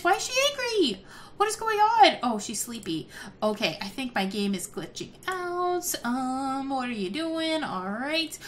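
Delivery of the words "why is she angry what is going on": high-pitched bewildered exclamation; baffled alarm. Real, not acted. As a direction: exclaim it in rising disbelief. Baffled by sim's sudden anger.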